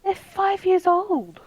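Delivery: slow, high, exasperated scolding. Real, not acted. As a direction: spell it out in disbelief. Scolding about the child.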